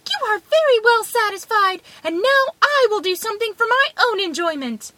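high, piping, defiant voice; little man crying out. A narrator's reading. Little man retorting to the wolf, high and defiant. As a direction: answer back in a tiny high voice. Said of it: "cried the little man".

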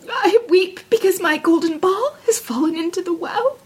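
high, plaintive princess voice; weepy princess, pitched well up; tearful princess. A narrator's reading. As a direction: lament it in a high, upset voice. Weeping princess explains her loss.

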